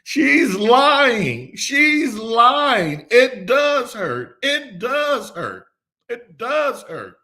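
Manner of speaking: put-on high, loud child's wail, slowing on the last lines; hollering child. Girl after her shot yells at her mother, high crying wail. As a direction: wail it out like a little girl who just got a shot. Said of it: "she started hollering".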